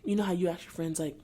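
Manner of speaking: usual speaking voice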